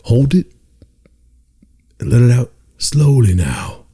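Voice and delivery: slow, lulling meditation instructor; meditation guide voice. Retold or imitated. Imitating the guru guiding listeners to breathe. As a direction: ease them into the breath like a sleep guide. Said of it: "he goes".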